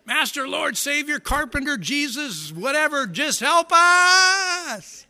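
high panicked shout, dragged out at the end; frantic high yell stretching the last words; panicked cry. Retold or imitated. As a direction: scream for help as the boat sinks. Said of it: "Or you just".

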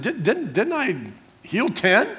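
high-pitched question with a stuttered start; stuttering. Real, not acted. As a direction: stumble over the opening DID, DIDN'T, then stretch it out high. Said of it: "He didn't stutter like I did".

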